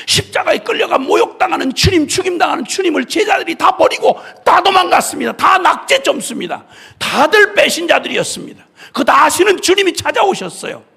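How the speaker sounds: high, loud preaching climax; impassioned shout. Real, not acted. Preacher crying out how the disciples fled, loud and high. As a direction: cry it out to the congregation.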